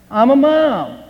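high, loud, proud snap of a reply; indignant retort. Retold or imitated. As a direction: fire it back at the clerk, offended. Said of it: "snapped Emily".

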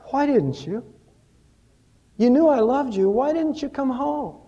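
a wounded husband begging an answer; pleading husband. Acted.

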